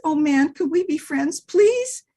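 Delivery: high, begging dog voice; high puppy pleading; pleading little dog. Acted. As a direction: beg sweetly for friendship. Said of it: "He said".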